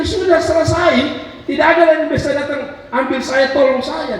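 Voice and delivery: high-pitched acted plea of a man giving up; despairing plea. Preacher voices David thinking all is over, high acted despair. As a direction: voice David's helpless thought, pitched well up.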